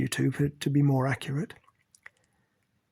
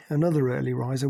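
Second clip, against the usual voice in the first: ordinary delivery, nothing marked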